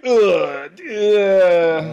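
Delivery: drawn-out groan of secondhand embarrassment; mock cringing groan. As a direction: groan like an awkward audience. Acts out listeners' reaction to joke.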